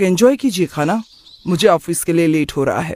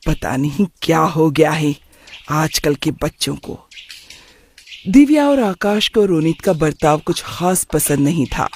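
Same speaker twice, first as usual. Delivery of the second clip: usual speaking voice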